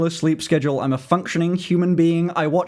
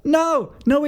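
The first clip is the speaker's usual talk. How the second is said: emphatic no; 10 semitones higher than usual. Real, not acted. Rejecting own idea.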